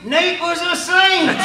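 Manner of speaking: high, loud, slow preacher shout; shouted punchline. Retold or imitated. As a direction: belt the punchline from the pulpit. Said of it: "and he says".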